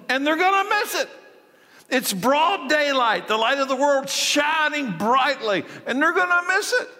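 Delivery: high, ringing pulpit voice building with fervor; preaching at full force. Acted. Pastor voicing Jesus's lament to the congregation, fervent preaching. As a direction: preach it out over the congregation, impassioned. Pastor climaxing on Jerusalem missing it.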